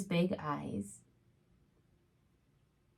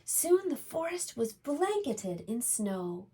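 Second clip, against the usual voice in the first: gentle storybook reading voice; reading to children; 3 semitones higher than usual. A narrator's reading. Reads a picture book aloud.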